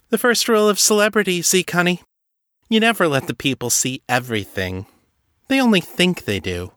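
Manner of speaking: high, fast, teasing purr; mocking diva voice. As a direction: toy with him like a starlet. Misty mocking Ezekiel.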